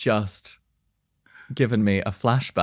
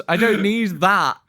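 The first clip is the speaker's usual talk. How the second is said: usual speaking voice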